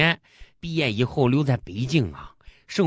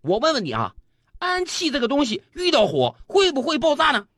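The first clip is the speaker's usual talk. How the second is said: mildly angry chemistry teacher, pitch climbing; acted stern interrogation; 9 semitones higher than usual. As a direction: question the pupil with rising irritation. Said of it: "非常愤怒地问一男同学".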